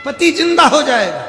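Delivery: high, loud ringing call; loud proclamation. Acted. Guru loudly promises the husband will revive. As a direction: announce it for all to hear. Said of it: "guru repeats the promise".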